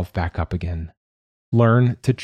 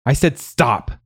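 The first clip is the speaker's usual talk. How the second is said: higher-pitched, exasperated order; angry command; 6 semitones higher, slower than usual. Acted. Mother ordering her crying son to stop, angry. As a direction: order it to end, heated.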